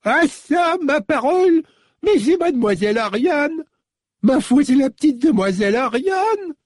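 put-on very high, cracked old lady voice, delighted surprise; an old servant recognising her young mistress; old woman exclaiming. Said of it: "puis s'exclama".

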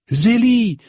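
high, bright cry of a name in delight; joyful cry. A narrator's reading. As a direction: call out her name, thrilled. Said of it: "s'écria-t-elle". (cri de joie)

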